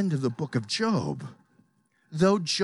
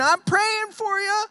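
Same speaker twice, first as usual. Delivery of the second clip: very high, breezy mock sympathy; smug mock Paul; 4 dB louder than usual. Preacher mocking Paul praying from a cruise liner, breezy and smug. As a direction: toss it off from a deckchair. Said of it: "sitting back on a cruise liner saying".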